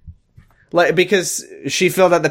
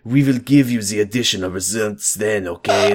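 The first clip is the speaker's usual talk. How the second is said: put-on French accent. Doctor gives Amy her test result plans in a French accent. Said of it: "In an outstanding French accent".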